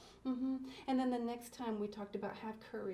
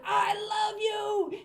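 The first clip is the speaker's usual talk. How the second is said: loud, high, slow holler; 12 semitones higher, 11 dB louder, much slower than usual. Shown as a demonstration. Host showing kids how to holler kind words at a dog. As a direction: yell it at the dog. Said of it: "But if you hollered".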